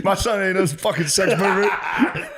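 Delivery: high, squealing mom voice through laughter; mock outraged mom. Laughing friend mimics an outraged mom defending her son. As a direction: squeak it out like an indignant mother. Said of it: "mother voiced in story".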